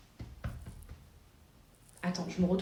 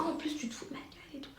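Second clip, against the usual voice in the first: hissed retort; 5 semitones higher, 11 dB quieter than usual. Retold or imitated. Stranger's angry retort retold.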